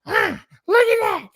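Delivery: high, loud, scandalized waitress voice; mocking the waitress. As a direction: play the shocked diner waitress gawking at the TV. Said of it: "this waitress like".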